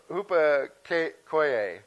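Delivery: "hupakoie" stretched very slowly, every syllable drawn out and falling; careful pronunciation. Shown as a demonstration. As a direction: spell out "hupakoie" at a crawl, deliberately. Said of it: "how they really pronounce these".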